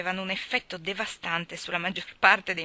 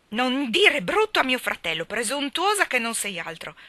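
put-on raised, loud child's rebuke; indignant exclamation; 5 semitones higher, 6 dB louder than usual. A narrator's reading. Geraldine defends her brother to Ivy, indignantly. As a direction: snap back in your brother's defence. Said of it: "esclamò Geraldine".

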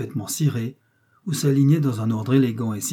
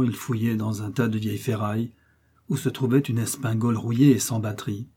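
ordinary delivery, nothing marked

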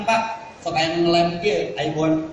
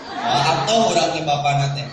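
ordinary talk, nothing marked

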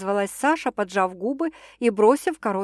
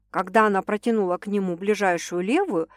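ordinary delivery

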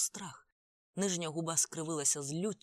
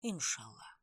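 low, soft, resigned murmur; hushed, lower-pitched answer; quiet reply; 4 semitones lower, 5 dB quieter, slower than usual. A narrator's reading. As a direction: let it drop away softly. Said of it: "відповів він тихо".

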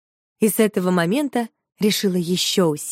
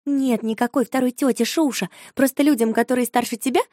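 ordinary delivery

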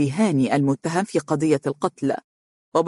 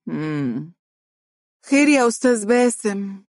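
irritated hum, then higher question; annoyed; 5 semitones higher than usual. A narrator's reading. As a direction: pout and ask impatiently what he wants. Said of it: "مطت شفتيها بضيق وقالت".

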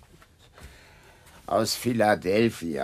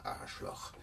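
unvoiced whispered curse under the breath; whispered insult; 10 semitones lower, 18 dB quieter than usual. Kimmel whispering a curse at the door. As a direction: hiss the insult to yourself. Muttered insult after doorbell.

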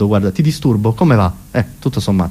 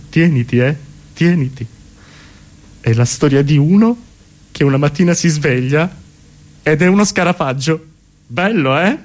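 Kafka/character. Acted. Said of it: "se l'avesse fatto con me Kafka, per dire".

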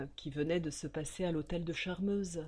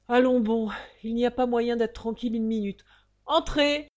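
irritated; 4 semitones higher, 7 dB louder than usual. Acted. Banker annoyed at knock.

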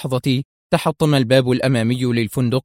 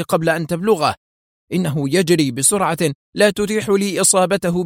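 higher, cursing the miss; angry curse; 7 semitones higher than usual. A narrator's reading. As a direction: snarl at the escaping target. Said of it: "صاح رجل نحيل بغضب". (قناص غاضب يلعن فشل رصاصته)

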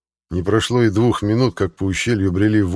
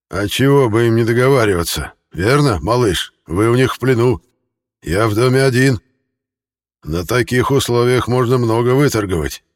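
higher, louder hoarse shout; 5 semitones higher, 4 dB louder than usual. A narrator's reading. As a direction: call down in a husky rasp. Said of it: "сиплый голос ответил". (сиплый голос)

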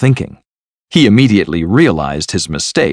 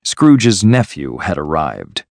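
ordinary delivery

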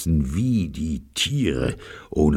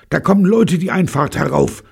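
high, loud, panting warning; gasping alarm; 7 dB louder than usual. A narrator's reading. Jean rushing in to warn the others, gasping and alarmed. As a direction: gasp out the alarm, pitched right up. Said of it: "keuchte sie".

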